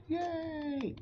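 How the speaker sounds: squeaky high cheer; a bus full of happy riders. Acted. Passengers cheering after the song, a high excited yay.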